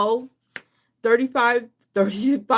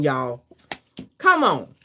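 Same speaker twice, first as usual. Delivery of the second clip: normal talking voice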